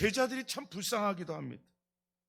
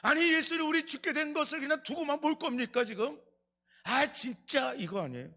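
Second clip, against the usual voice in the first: complaining cry; 7 semitones higher than usual. Retold or imitated. Disciples protesting to Jesus in the storm, complaining cry. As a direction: whine at the master like you're sinking. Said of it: "원망하잖아요".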